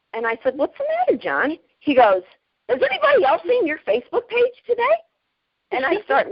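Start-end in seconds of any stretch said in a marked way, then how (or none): 0.6-1.6 mock concern. Retold question to a regular. 2.7-5.0 high, fast, scandalized quoting of a shocked customer; flabbergasted customer. Retold or imitated. A regular customer, shocked by her photos, flabbergasted and squeaky. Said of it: "he's all flabbergasted".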